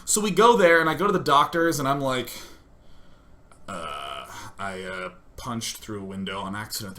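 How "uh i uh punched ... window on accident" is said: very low, soft mumble; hesitant, embarrassed admission; sheepish. Retold or imitated. Patient admitting his accident to doctors, sheepish.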